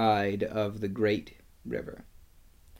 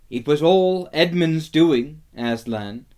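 earnest report; 5 semitones higher, 6 dB louder than usual. A narrator's reading. Peter tells Aslan of battle.

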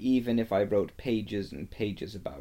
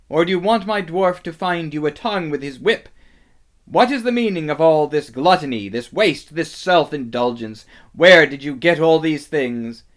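loud, fast, threatening tirade; angry interrogation; 5 semitones higher, 9 dB louder than usual. A narrator's reading. Witch berates feasting animals over their gluttony, angry interrogation. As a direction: rattle off furious accusations as the witch.

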